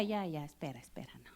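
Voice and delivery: whispered reassurance; soothing whisper. Retold or imitated. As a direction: hush her gently. Young man calming her down.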